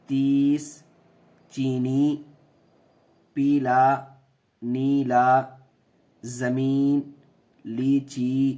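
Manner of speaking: تیر بیس پیلا نیلا with the long ی clear; clear word list. Shown as a demonstration. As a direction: read each word distinctly. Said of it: "کھڑی زیر کی آواز".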